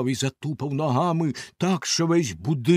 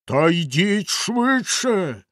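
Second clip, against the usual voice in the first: a loud, slow, higher roar; terrible bellow; 6 semitones higher, 5 dB louder, much slower than usual. A narrator's reading. Bluebeard yelling up to his wife, a terrible roar. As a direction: bellow up the stairs. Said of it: "знову заволав синя борода".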